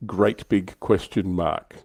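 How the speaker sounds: normal talking voice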